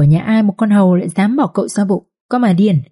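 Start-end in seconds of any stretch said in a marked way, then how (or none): none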